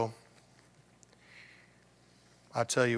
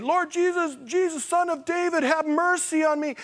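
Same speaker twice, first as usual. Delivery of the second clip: much higher, pleading cry for mercy; high-pitched, begging plea from the blind man; 3 dB louder than usual. Acted. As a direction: call out desperately from the roadside. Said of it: "crying out".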